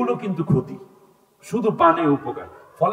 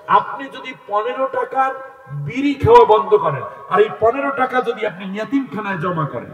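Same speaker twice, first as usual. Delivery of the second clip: raised, high-pitched sermon delivery; impassioned preaching; 7 semitones higher, 3 dB louder than usual. Real, not acted. Preacher urging giving to orphans instead of smoking, impassioned. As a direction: press the appeal hard, voice lifted high.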